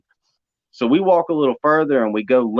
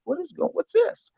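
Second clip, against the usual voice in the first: startled; 5 dB quieter than usual. Retold or imitated. Sees light in woods.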